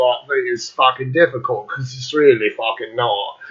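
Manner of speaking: casual fake British accent; put-on English accent. Showing that imitating the British is easy, English accent. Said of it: "you can put on English accent".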